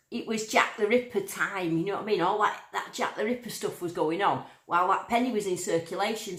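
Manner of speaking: ordinary talk, nothing marked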